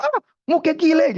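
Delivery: high, scornful Betawi retort; acted Betawi father scoffing; Betawi speech. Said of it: "gitu ya, bahasa orang Betawi".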